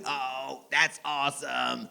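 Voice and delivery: slow, soft, impressed robot; quiet, deadpan android wonder. Acted. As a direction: marvel in a measured robot monotone. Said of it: "I talk and act like a robot".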